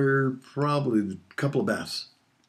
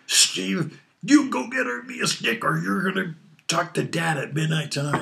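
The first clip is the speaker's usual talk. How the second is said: much higher scolding mom voice, voice pitched way up; furious mom; 7 semitones higher than usual. Retold or imitated. Mother rejecting the tiny stick, a shrill high scolding. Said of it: "She came back".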